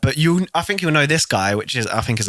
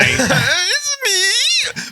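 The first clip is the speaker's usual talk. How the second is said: squeaky Mario voice. Retold or imitated.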